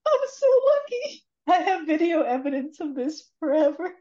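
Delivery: very high, squeaky, giddy voice; retold gleeful mockery. Rach laughing at him over the video evidence, high mock glee. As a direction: imitate her crowing, high and giddy. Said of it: "Rach just starts laughing at him".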